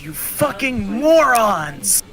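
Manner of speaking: loud, high, drawn-out mock fury; angry yell. Coach leaning in to berate security, angry yell. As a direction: imitate the coach yelling, stretching the insult.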